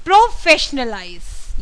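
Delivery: "professionalize" said very slowly, the "fish" syllable leaned on hard and drawn out; stress on fish. Shown as a demonstration. Teacher showing students where the stress falls, slow and emphatic. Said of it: "मैंने फिश पे कितना ज़्यादा जोर दिया".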